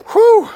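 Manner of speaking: high, loud gasping whew; breathless whew. Real, not acted. Out of breath from the hill, a loud high whew. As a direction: puff it out, winded. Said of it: "That hill should not have got me out of breath".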